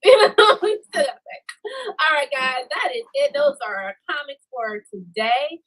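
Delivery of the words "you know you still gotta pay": laughing. Real, not acted. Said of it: "repeats punchline laughing".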